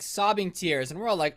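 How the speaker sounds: ordinary talk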